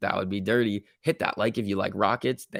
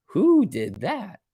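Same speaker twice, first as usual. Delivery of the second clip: astonished; 9 semitones higher, 3 dB louder, much slower than usual. Real, not acted. Repeats question, slower and higher.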